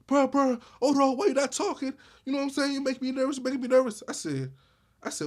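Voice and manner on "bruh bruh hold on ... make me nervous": anxious friend voice. Retold or imitated. Imitating anxious best friend.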